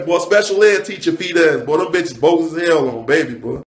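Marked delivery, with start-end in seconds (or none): none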